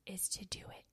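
hushed whisper from God. Acted. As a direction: breathe it out as a secret urging. God's voice drops to a whisper.

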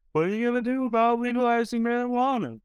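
high, mocking teen voice; mock high schooler. Students quizzing the candidate, mocking high teen voice. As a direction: ham up a clueless student's question. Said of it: "all the standard high school questions".

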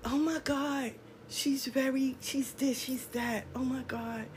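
very soft imitation of admirers; hushed mock admiration. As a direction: murmur it like an awed onlooker. Mimics admiring onlookers, very softly.

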